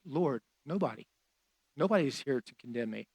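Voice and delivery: soft, low, a hint of a humble woman; humble quiet answer. Forgiven woman answering Jesus, soft and low. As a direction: say it quietly, bowed before him.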